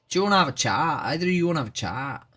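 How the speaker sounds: playful put-on Irish lilt, quick; mock Irish accent. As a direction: tease the chat in a hammed-up Irish accent. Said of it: "Have you never heard an Irish accent before?".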